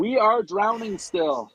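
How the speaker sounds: slow insistence. Real, not acted. Insists they are drowning.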